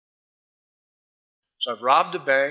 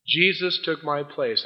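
ordinary delivery

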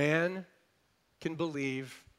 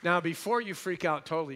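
usual speaking voice

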